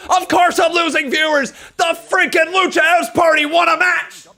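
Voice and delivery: high, loud exasperated rant; exasperated shout. Real, not acted. Streamer to chat, fed up with the match result, yelling. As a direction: yell it out in disbelief. Ranting about Lucha House Party win.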